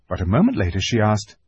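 plain reading voice

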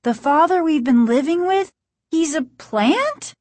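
high, shocked question; crying out. A narrator's reading. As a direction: ask in stunned disbelief. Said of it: "Margaret cried".

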